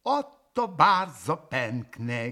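plain reading voice

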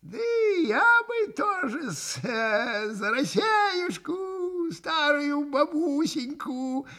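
very high, slow, giggly little voice; подхихикивая. A narrator's reading. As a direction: wheedle along in a drawn-out falsetto. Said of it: "подхихикнул Максимов". (тоненько, протяжно, с хихиканьем)

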